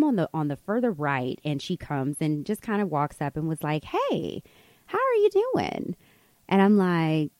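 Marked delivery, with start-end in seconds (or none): 3.8-6.0 mock friendly stranger, high-pitched; overfamiliar greeting. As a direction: imitate a stranger's chummy, sing-out hello.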